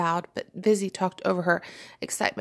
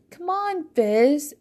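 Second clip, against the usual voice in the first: high sisterly call, growing louder; shouted appeal; 8 semitones higher, 4 dB louder, much slower than usual. A narrator's reading. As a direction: shout a coaxing plea to a sister. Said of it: "Tranquilla's voice shouted".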